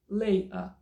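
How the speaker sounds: LATER with no T, a break before 'uh'; dropped T and R. Shown as a demonstration. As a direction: swallow the T in LATER, drop the R. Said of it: "cinco opções".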